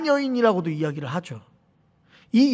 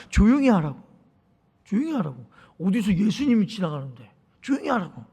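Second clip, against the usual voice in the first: low-key, softer imitation of a crowd shushing someone; retold hushing; 4 semitones lower, 6 dB quieter than usual. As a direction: hush the beggar under your breath, lightly acted. Crowd hushes the blind beggar. (나지막이 입막음하는 군중 흉내)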